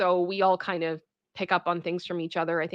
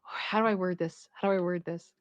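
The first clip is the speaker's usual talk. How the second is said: softer, muse under your breath; thinking aloud; 2 semitones lower, 7 dB quieter than usual. Real, not acted. Streamer searching for careful wording, thinking aloud.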